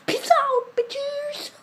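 sudden high squeal; squeaky sign-off. Acted. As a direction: squeal the goodbye playfully. Cheeky sign-off to viewers.

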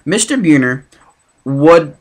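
ordinary delivery, nothing marked

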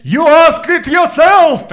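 high, slow, loud indignant shout; grandpa yelling. Pretend. Grandpa yelling at the grandkids who asked, loud high shout. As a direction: bellow it back like an offended old man. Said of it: "And I'm like".

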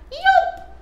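very high yelp. Acted. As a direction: squeak it out. Peacock recap.

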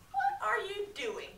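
high, slow teasing taunt. A narrator's reading. Boy teasing the wolf from his fire, high and slow. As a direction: tease the hungry wolf. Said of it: "teased Kip".

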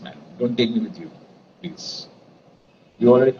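ordinary talk

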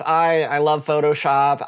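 normal talking voice

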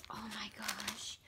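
breathless whisper of awe; a soft amazed whisper over a gift; whispered oh my gosh. Real, not acted. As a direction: hush it out in disbelief. Overwhelmed by gift.

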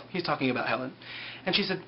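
normal talking voice

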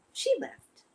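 plain reading voice

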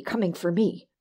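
usual speaking voice

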